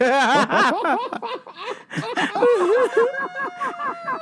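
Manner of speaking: high helpless laugh; long wheezy burst of laughter; laughing fit. Real, not acted. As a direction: crack up at your own punchline. Laughing at own retold reply.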